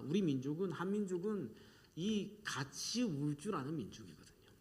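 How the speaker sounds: ordinary delivery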